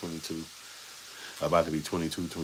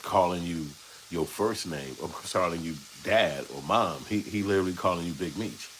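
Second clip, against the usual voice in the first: usual speaking voice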